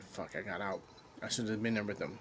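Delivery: low, hushed self-reproach; hushed regret. Retold or imitated. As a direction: murmur it to yourself in regret. Regretful inner thought, retold.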